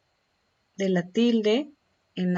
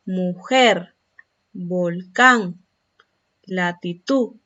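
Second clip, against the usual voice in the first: Mujer, volcán, latitud with louder final syllables; stress on last syllable; 4 dB louder, much slower than usual. Shown as a demonstration. Teacher giving examples of agudas, final syllables of Mujer, volcán, latitud stressed. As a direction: punch the endings: mu-JER, vol-CÁN, lati-TUD. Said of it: "presentan el acento".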